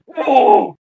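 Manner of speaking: high, loud whoa; startled whoa. Retold or imitated. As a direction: jolt back in shock. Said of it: "and I'm like".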